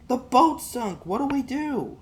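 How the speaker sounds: put-on high, pleading boy's voice; a desperate kid over the sunk boat; panicked boy. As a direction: beg your dad to do something.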